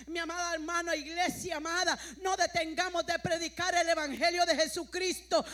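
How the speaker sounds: loud, rising pulpit call; rally the congregation with growing volume; preaching exhortation. Acted. Preacher urging the church to keep preaching the gospel, loud exhortation.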